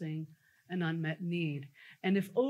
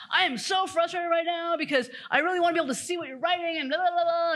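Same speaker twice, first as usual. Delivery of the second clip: much higher, fast, a bit louder rant; frustrated rant; 9 semitones higher, 6 dB louder, faster than usual. Retold or imitated. Head of people venting to the coach in front of her CEO, fast and frustrated. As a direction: spill the complaint in a rush. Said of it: "she just goes on".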